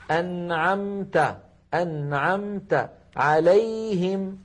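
slow "أنعمت" with nun and meem held equally. Shown as a demonstration. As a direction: chant "أنعمت" slowly, evenly lingering on both nasals. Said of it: "فزمنهما متساو". («أنعمت» بزمن متساو للنون والميم)

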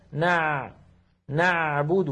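"نعبد" with sound flowing briefly through the ayn; ayn slightly flowing. Shown as a demonstration. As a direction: let the ayn in "نعبد" run a little. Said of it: "لا بد من جريان الصوت قليلا". («نعبد» بجريان الصوت قليلا في العين)

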